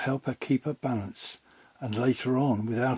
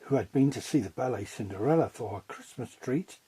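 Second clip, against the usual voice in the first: ordinary delivery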